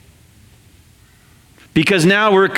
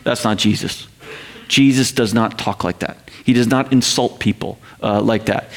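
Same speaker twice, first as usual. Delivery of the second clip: mock prim objector; 7 semitones lower, 3 dB quieter than usual. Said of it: "some of us in North America that goes".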